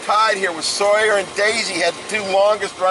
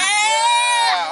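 excited cheer. Real, not acted. Cheering with the kids.

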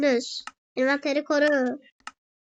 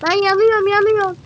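louder mock wail; burning chicken cry; 3 semitones higher, 6 dB louder, faster than usual. Voicing the roasting chicken, a mock loud wail.

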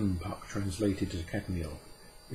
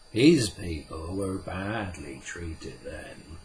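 Puck, slow and solemn; 2 semitones lower, slower than usual. Acted. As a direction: lay down the old law slowly and gravely. Puck's voice in the story.

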